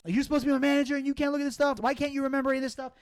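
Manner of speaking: rapid-fire, high-pitched scolding; fast mock tirade; retold yelling. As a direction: imitate an irate boss firing off questions. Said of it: "he starts yelling at me like".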